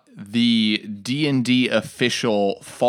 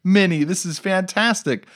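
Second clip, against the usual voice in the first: high, awestruck mock gushing; imitating excited students; 9 semitones higher than usual. Students thrilled at the ship on the table, imitated excitement. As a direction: gush in a higher voice, imitating thrilled players. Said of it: "their excitement of".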